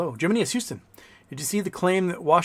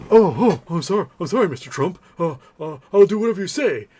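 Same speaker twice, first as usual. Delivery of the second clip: high, louder, hammed-up cringing billionaire; mock Bezos cowering before Trump, higher and louder; mock grovelling; 4 semitones higher, 5 dB louder than usual. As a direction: whimper and beg like a scared pushover.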